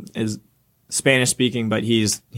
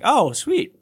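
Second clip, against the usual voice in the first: sudden high oh sweet; surprised relief; 10 semitones higher than usual. Retold or imitated. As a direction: jump up in pitch with relief. Relieved reaction.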